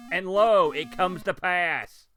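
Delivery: hammed-up prophet's pronouncement, loud on lo then slow; mock biblical proclamation. Gamer mock-proclaiming the third level appears, slow grand intoning. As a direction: intone it like scripture, tongue in cheek. Game shows the demanded level.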